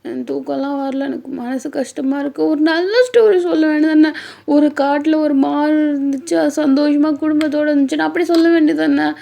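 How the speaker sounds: high, loud, put-on wifely whine; whiny complaint. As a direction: complain like an upset, pouting wife. Acted wife complaining about sad story.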